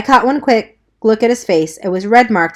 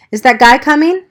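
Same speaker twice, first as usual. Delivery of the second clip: shouting over fire; 6 semitones higher, 6 dB louder than usual. Acted. Shouting in burning church.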